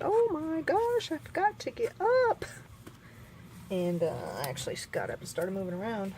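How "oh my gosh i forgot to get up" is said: retold alarm. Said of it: "and I said".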